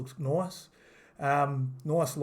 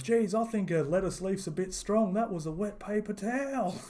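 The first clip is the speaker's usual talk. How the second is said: higher, goofy nerdy-dad quip; dad joke voice; 6 semitones higher than usual. Retold or imitated. Commentator mimicking a goofy dad joke. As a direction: ham it up like a corny dad cracking a pun. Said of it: "dad joke voice".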